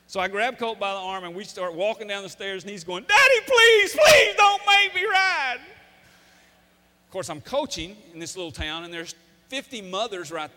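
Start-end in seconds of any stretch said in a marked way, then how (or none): 3.0-5.6 hammed-up screaming plea, far higher and much louder; squalling child. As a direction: imitate a terrified boy shrieking to his dad. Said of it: "He's still squalling".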